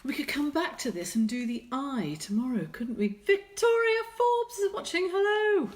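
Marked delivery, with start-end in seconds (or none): 3.1-5.7 high bright call to a viewer; excited greeting. Real, not acted. Greeting a live viewer who just joined, high and loud. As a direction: sing out the hello, pitched way up.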